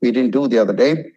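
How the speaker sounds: normal talking voice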